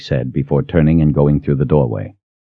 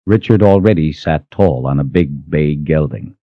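ordinary delivery, nothing marked

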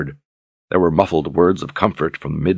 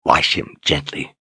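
put-on gentle old wizard; 2 semitones lower, slower than usual. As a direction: hand over the baby tenderly. Zed hands over the newborn.

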